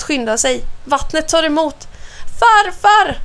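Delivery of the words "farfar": high, loud cry for grandfather; calling out. A narrator's reading. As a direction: call out urgently for help. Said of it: "ropar han". (ropar på farfar)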